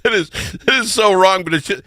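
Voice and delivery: high, fast, breathless with real laughter; laughing helplessly as the words tumble out; laughing till crying. Real, not acted. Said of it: "I'm crying a little bit".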